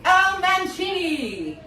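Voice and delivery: high, loud, drawn-out introduction; big stage introduction; grand announcer. Retold or imitated. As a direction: stretch the name like a ring announcer. Announcing teacher's grand entrance.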